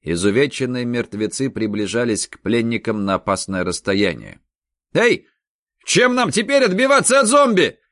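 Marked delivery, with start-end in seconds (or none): 4.9-7.8 high loud alarmed shout. A narrator's reading. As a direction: call out in alarm. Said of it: "выкрикнул Попушкин".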